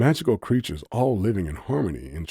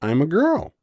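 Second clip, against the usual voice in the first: pouty grumble; 10 semitones higher, 7 dB louder than usual. A narrator's reading. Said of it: "Cynthia pouted and grumbled".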